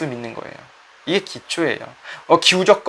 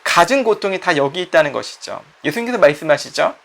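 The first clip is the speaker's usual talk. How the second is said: ordinary talk, nothing marked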